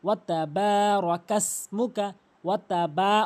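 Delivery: বা stretched within ওয়াতা বা রকাস মুকা; one-count elongation. Shown as a demonstration. Teacher modelling for learners, measured recitation with long বা. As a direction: lengthen the vowel of বা as in recitation. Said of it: "এক আলিফ টেনে পড়বেন".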